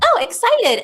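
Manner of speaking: high, loud gushing oh; mock excited. Shown as a demonstration. Teacher mimicking her own mood swings, excited. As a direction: swing up into over-the-top excitement. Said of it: "not excited".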